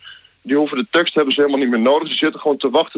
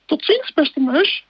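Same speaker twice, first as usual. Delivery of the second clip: very high, slow, squeaky snake exclamation; a scared snake blurting it out; high squeaky snake in a fright; frightened snake voice; slower than usual. Acted. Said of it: "Echt fantastisch met al die stemmetjes".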